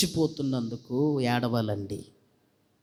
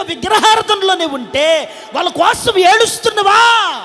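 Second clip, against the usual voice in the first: loud, high challenging questions; full-force preaching; 14 semitones higher, 8 dB louder than usual. Real, not acted. Preacher challenges parents about their children, high shouted preaching. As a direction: shout each question at the congregation.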